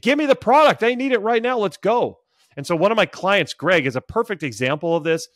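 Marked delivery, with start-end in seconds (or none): 0.0-2.2 urgent demand. Pretend. Buyer urgently demanding the product.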